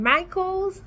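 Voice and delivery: ordinary talk, nothing marked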